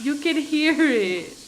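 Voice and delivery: slow, loud call across the room. Real, not acted. Calling to someone off mic.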